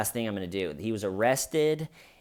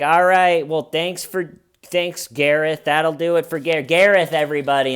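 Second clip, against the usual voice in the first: host sign-off; 6 semitones higher, 8 dB louder than usual. Retold or imitated. Host wrapping up set.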